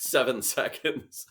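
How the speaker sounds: chuckling through seven seconds; laughing repeat. Retold or imitated. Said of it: "The way he said it was pretty sharp".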